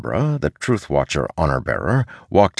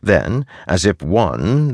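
usual speaking voice

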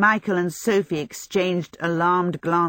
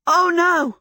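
high, loud cry of alarm; 11 semitones higher, 7 dB louder than usual. A narrator's reading. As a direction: shout in sudden panic. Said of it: "Michael cried out".

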